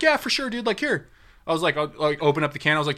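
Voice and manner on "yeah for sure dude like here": pitched way up, eager; high eager voice. Retold or imitated. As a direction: hand it over brightly. Eagerly offering dip, high.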